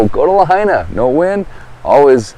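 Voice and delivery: usual speaking voice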